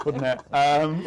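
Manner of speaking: ordinary delivery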